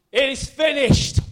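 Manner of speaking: loud, high, slow proclamation; preacher's shout. Retold or imitated. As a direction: shout it out slowly in triumph. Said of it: "Sorry, I'm shouting".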